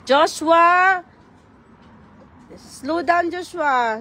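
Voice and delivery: loud call across the park; sharp playground warning. Real, not acted. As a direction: call his name out sharply. Mom calling child across park.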